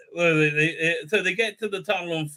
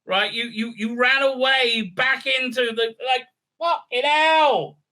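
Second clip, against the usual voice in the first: angry rant; 9 semitones higher than usual. Real, not acted. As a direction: rant at the show's writers. Ranting at the show.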